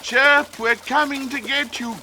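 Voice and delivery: high, carrying call to a friend. A narrator's reading. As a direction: call across the water to reassure. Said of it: "called Peep".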